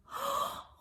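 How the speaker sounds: very high crying gasp; father gasping in shock. Acted. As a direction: gasp in dismay. Kalba Savua sees Rachel honored.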